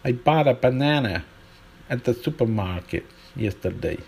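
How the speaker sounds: American "bought" with ah, flat-a "banana"; American accent. Shown as a demonstration. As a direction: put on an American accent for contrast. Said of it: "imagine this sentence".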